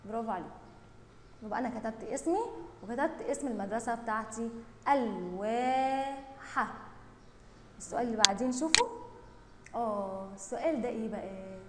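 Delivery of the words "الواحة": very slow, stretched "الواحة"; drawn-out word. Shown as a demonstration. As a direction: sound out "الواحة" with long vowels. Teacher sounds out word while writing. (ببطء مع مدّ الحروف)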